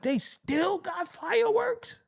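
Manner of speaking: mock surprised old man. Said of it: "You be like".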